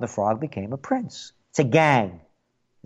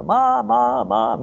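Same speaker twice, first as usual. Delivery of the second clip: calling up to mom; 7 semitones higher, much slower than usual. Retold or imitated. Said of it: "I yell up to my mother".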